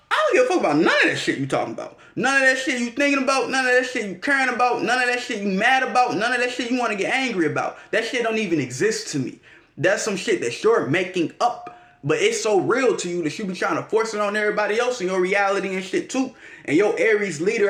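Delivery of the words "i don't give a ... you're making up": higher, fast, brushing it all off; dismissive rant. Retold or imitated. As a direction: rattle it off like none of it matters, pitched up. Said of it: "you would know".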